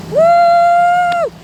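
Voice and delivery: high, loud whooping hoot; long, high excited woo. Retold or imitated. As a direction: whoop like a cheering crowd. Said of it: "went, woo!".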